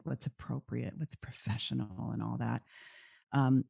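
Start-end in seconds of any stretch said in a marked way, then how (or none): 0.0-2.6 softer, with a touch of sarcasm; mildly mocking. Real, not acted. Guest on what counts as appropriate and professional, mildly mocking. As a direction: toss it off with understated mockery. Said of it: "almost mocking tone there".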